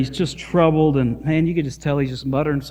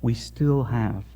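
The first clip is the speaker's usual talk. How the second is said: quiet sermon close; 3 semitones lower, 6 dB quieter than usual. Acted. Soft sermon application.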